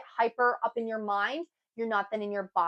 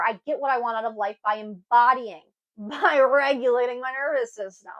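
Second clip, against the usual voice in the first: usual speaking voice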